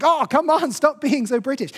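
mock outrage at their politeness; exasperated. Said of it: "And he's like".

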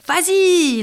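loud, high, drawn-out cheer; cheering encouragement. A narrator's reading. Witches urge Marine to fly, excited cheering. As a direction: egg her on loudly. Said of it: "l'encouragèrent les sorcières".

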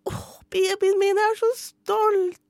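high, slow mock proud mother; gushing mum. Mother gushing over her teenager at graduation, high mock pride.

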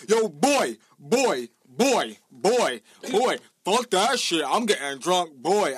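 loud, rowdy mock of girls shouting on the bus; rowdy Brooklyn girls. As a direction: bellow it like a loud gang of girls. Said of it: "All I hear is".

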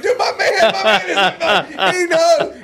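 high, loud and laughing through the words. Real, not acted. Dad telling the story laughs loudly, high and delighted. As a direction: crack up while getting the words out.

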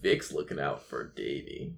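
very soft, low echo; hushed in-character aside; low murmur. Acted. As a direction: drop right down and say it quietly. Echoes the line in character.

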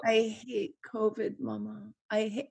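put-on soft, drowsy child's murmur; sleepy mumble. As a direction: fade out like a kid falling asleep. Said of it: "drifted off to sleep ... going".

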